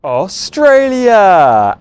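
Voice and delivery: slow loud announcement; grand announcement. Shown as a demonstration. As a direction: proclaim Australia. Announces country.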